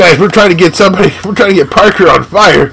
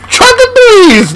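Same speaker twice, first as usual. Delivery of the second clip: excited outcry; 12 semitones higher than usual. Real, not acted. Reacting to the shot.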